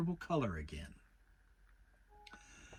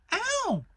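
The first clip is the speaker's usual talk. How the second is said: very high, loud squeaky ow; tiny piglet yelping; cry of pain; 7 dB louder than usual. A narrator's reading. Piglet yelping while being scrubbed in the bath, high squeal. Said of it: "cried Piglet".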